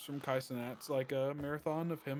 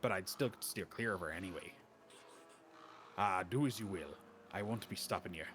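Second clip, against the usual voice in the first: put-on almost-Irish accent; 5 semitones lower than usual. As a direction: spin a warning tale in a low almost-Irish lilt. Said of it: "where I pulled that accent out of".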